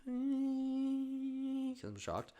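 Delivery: high, slow dazed soldier; shell-shocked soldier. Pretend. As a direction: stagger through it like a shocked soldier. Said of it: "Scene from Saving Private Ryan".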